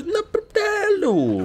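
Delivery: high, slow, laughing cry; laughing yelp. Real, not acted. Gamer startled mid-jump, a laughing yelp. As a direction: yelp it out while cracking up.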